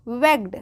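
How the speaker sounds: वेग्ड as one syllable, "vegd"; correct pronunciation. Shown as a demonstration. Teacher answers how it is read, correctly pronounced. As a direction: say वेग्ड with g and d run together. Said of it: "क्या हो जाएगी?".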